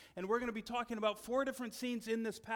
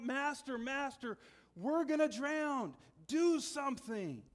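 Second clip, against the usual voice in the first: higher, louder, slow frantic plea; panicked cry; 5 semitones higher, 4 dB louder, slower than usual. Retold or imitated. Disciples beg Jesus in the storm, panicked cry. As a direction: cry out to the master as the boat floods. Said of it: "their response is to cry out".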